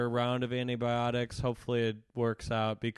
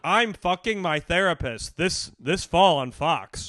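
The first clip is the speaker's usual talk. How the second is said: TV promo announcer; 5 semitones higher, 8 dB louder, slower than usual. Pretend. Mock TV promo.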